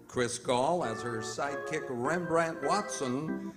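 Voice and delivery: ordinary talk, nothing marked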